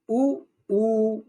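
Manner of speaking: उ once short, then उ held long. Shown as a demonstration. Teacher demonstrating vowel length to students, short then long. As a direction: say उ quick, then stretch उ out. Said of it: "फिर से देखिए".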